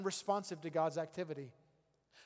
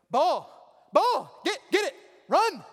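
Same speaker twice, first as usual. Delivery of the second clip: screaming at the TV, much higher and louder; calling the ball; 10 semitones higher, 12 dB louder, slower than usual. Retold or imitated. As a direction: yell like a fan watching a touchdown. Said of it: "it begins with something like this".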